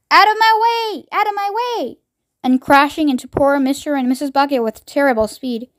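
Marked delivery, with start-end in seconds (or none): 0.1-2.0 high shouted order to clear the way; an old woman bellowing people aside; shouting. A narrator's reading. Grandma Georgina shouting while crashing through the elevator.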